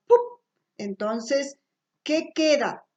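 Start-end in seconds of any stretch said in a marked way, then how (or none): 0.1-0.4 "pup" as one small pop of p; isolated p sound. Shown as a demonstration. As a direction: pop "pup" as a lone consonant. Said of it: "cambio por".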